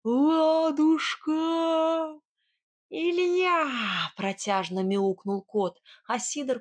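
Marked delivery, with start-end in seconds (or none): 0.0-3.7 loud, higher, drawn-out roaring call; bellowed call from behind; monstrous roar. Acted. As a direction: roar the name out slowly. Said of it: "раздался чудовищный рёв".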